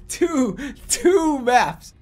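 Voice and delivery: very slow, high words pushed through laughter; can barely get it out for laughing. Real, not acted. Gamer cracking up at two maps.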